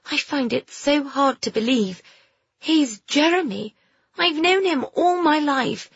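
high, hurt disbelief; dismayed girl Phoebe. Acted. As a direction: struggle to accept a betrayal. Story figure, disbelieving girl.

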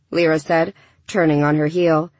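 ordinary delivery

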